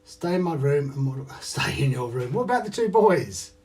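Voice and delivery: hammed-up Welsh lilt; put-on accent. Mocking stay in my room in a Welsh-sounding accent. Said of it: "That's Welsh, isn't it?".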